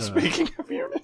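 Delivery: squeaky high-pitched echo; high mocking echo.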